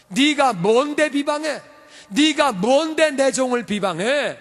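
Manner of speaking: slow, high, stern rebuke; slow divine rebuke. Retold or imitated. God rebuking Miriam, slow and stern. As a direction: drag out each word in anger.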